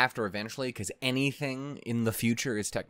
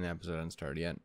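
soft, low pleading, as to a restless pet; soft plea; 6 semitones lower, 7 dB quieter, faster than usual. Pretend. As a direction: coax him gently, almost under your breath. First soft plea to Ren.

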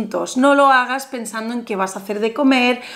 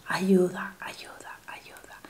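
fading breathy whisper, each repeat fainter; whispered mantra; 6 semitones lower, 12 dB quieter, slower than usual. Acted. Guide to viewer during the visualization, a fading whisper. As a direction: breathe the word out until it vanishes.

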